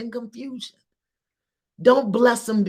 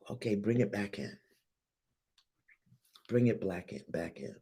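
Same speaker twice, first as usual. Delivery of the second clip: calm guiding; 9 semitones lower, 10 dB quieter than usual. Real, not acted. Guiding listeners back, calm close.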